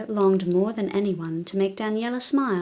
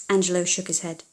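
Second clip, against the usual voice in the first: ordinary delivery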